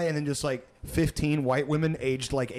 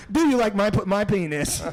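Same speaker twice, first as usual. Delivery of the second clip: higher, louder glitching robot; robot voice switching; 6 semitones higher, 6 dB louder than usual. Pretend. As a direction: switch settings mid-sentence.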